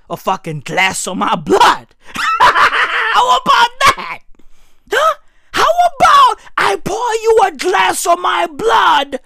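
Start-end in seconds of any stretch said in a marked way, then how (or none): 2.1-9.2 laughing shout. Real, not acted. Comedian laughs while mock-offering his blood to his partner.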